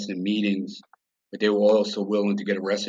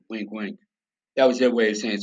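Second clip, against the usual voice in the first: ordinary talk, nothing marked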